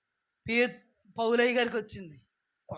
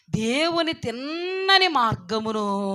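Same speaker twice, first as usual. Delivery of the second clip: loud, long-drawn vowels; slow, ringing preacher delivery; forceful declamation; 6 dB louder, much slower than usual. Acted. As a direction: stretch the phrase out like a proclamation. Preacher declaiming the verse's phrase.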